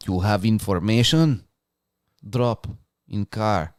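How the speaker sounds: mock Russian accent, halting between words. Co-host voices the suited stranger pulling up on you, Russian accent. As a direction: play a shady Russian stranger with a thick accent. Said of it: "a Russian accent pulls up on you".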